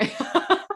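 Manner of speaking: a high, bright burst of laughter. Real, not acted. Host laughing at her own nervous laughter, high. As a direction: laugh at yourself.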